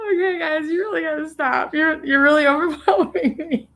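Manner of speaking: choking up, voice high and teary; tearful. Real, not acted. Streamer thanking generous viewers, on the edge of tears. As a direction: fight back real tears while thanking. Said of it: "you're very close to seeing me cry".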